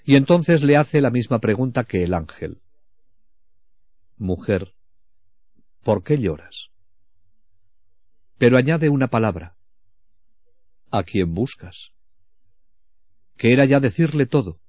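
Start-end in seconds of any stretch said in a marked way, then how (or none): 4.2-6.7 soft, lower, gentle asking; tender question. Retold or imitated. Jesús habla a María llorando. 10.9-11.9 slow, softer and lower; tender question. Retold or imitated. Jesús pregunta a María.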